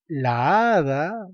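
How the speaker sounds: slow "La hada" with the a's merged into one long vowel; drawn-out cacophony. Shown as a demonstration. Teacher showing the clash with a silent H, drawn-out cacophony. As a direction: stretch the joined a's in "La hada". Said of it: "estoy produciendo cacofonía".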